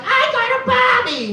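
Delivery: put-on very high, girlish squeak; little girl voice. Little girl proudly shows the class her doll, high squeak.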